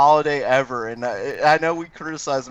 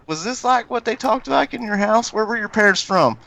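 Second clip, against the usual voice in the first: higher, mock Southern questioning; Southern accent; 10 semitones higher than usual. Co-host quizzing where the parents are from, put-on Southern accent. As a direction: imitate an Ohioan's Southern twang. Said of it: "they sound like this".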